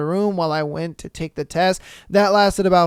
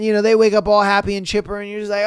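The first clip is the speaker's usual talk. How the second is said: normal talking voice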